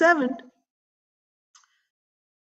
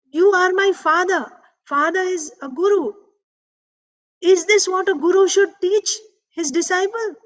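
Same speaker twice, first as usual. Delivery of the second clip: put-on high-pitched, indignant child scolding his father; a small boy furious at his father's words, pitched way up; boy angrily confronting his father, high and outraged; angry little boy; 8 semitones higher than usual. A narrator's reading. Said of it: "and very angry, he was very angry".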